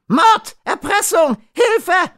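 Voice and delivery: shrieked cries for help, very high and loud; screaming. A narrator's reading. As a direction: scream desperately for someone to come. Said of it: "schrie Bakaliko".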